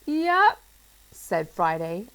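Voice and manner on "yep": a hopeful yep, pitched up; unsure yep. A narrator's reading. Friday trying to sound sure while they wait. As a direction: bluff a confidence you don't feel. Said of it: "a little less confidently this time".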